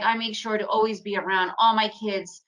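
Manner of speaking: normal talking voice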